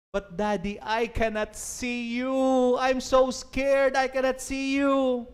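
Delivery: high, slow frightened plea; pleading scared girl. Acted. Trapped girl pleads with her father, slow and frightened. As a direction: beg her daddy, scared she cannot see.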